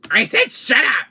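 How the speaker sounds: exasperated full-voice yell; angry shout. Acted. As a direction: shout them down, leaning on each word. Yelling at the voice to stop.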